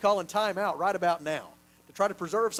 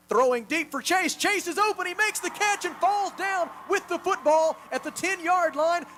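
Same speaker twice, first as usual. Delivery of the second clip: excited play-by-play; 7 semitones higher, 3 dB louder than usual. Real, not acted. Play-by-play of a deep completion.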